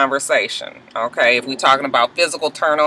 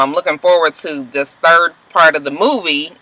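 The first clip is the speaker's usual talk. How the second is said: normal talking voice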